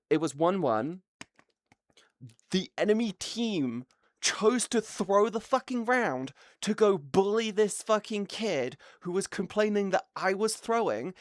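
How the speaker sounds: usual speaking voice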